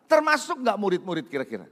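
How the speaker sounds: usual speaking voice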